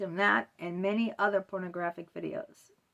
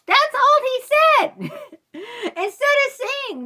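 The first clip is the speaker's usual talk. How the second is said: higher and louder, outraged at how little he said; indignant disbelief; 12 dB louder than usual. Real, not acted. Client venting about her therapist's reply, high indignant outburst. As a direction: burst out, appalled, in a high strained voice.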